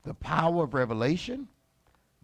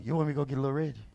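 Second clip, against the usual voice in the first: ordinary talk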